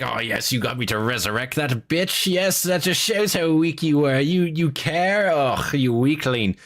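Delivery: high, sneering villain voice; mocking Erebus voice. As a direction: sneer it like a smug traitor. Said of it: "he's just saying".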